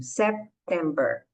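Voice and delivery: September slow, P only a slight lip closure; slow careful September; lips barely closed. Shown as a demonstration. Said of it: "fecha a boca um pouquinho".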